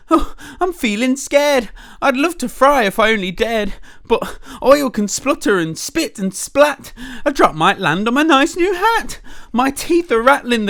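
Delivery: high, loud trembling fright; scared cook. Acted. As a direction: wail it like a wimpy cook. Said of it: "the cook declared".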